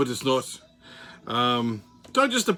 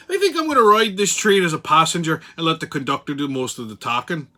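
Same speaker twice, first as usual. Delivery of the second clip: whimsical Irish lilt; Irish accent. Pretend. Host ranting to listeners as an Irishman, whimsical Irish accent. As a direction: put on a playful Irish brogue. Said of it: "enough of the Irish accent for now".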